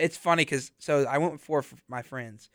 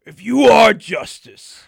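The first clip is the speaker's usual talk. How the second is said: loud, drawn-out dramatic proclamation; the Riddler; 12 dB louder, much slower than usual. Retold or imitated. Co-host quoting the movie villain to a friend, slow overdramatic villain voice. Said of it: "He's like".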